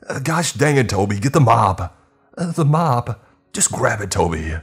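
high, loud frantic orders; panicked yelling. Acted. Worker yells at Toby to fetch the mop. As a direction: yell desperately at Toby.

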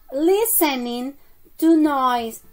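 very slow, stretched-out words; teacher dictating word by word; slow model phrase. Shown as a demonstration. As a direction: draw each word out for learners.

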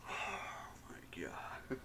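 breathless whisper. Real, not acted. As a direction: whisper it, spent from laughing. Breathless after laughing fit.

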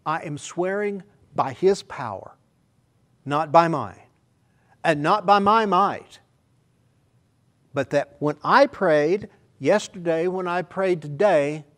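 loud, high and slow preaching; preacher proclaiming his oath to the church; fervent preaching. Acted. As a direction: proclaim it with full fervor. Preaching at full force.

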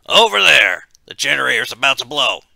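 loud, high, urgent shout; shouted warning. A narrator's reading. Warning the others the generator will blow, urgent shout. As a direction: shout it across the room as an alarm. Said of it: "looked over at the power room door and shouted".

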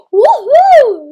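loud high whoop; happy cheer. Real, not acted. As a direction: whoop in triumph. Cheer in game.

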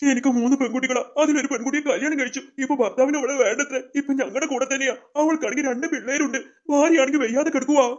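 put-on plaintive, upset old man; tearful. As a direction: tell the hardship close to crying. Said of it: "അപ്പൂപ്പ കരയില്ലേ, സങ്കടാവുന്നു".

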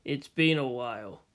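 low, soft, cold greeting; friendly words, icy underneath; cold friendliness. Acted. Gendo greeting his son, low and cold. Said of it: "his words friendly, his tone ... not".

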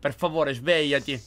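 normal talking voice

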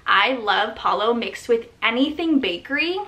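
normal talking voice

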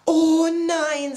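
high, loud, drawn-out cry of dismay; tearful wail. A narrator's reading. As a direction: wail like an upset little character. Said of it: "dabei musste er weinen". (weinerlich aufheulen)

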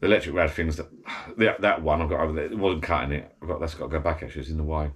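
normal talking voice

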